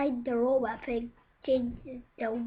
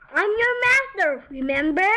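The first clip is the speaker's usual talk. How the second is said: loud, high plea; pleading cry; 6 semitones higher, 7 dB louder than usual. Acted. Wolf pleading with the robot pig, loud. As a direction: beg the robot to remember you.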